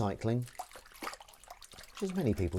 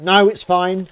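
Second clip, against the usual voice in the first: dismissive loud quote; 8 semitones higher, 12 dB louder, slower than usual. Retold or imitated. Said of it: "but I was like".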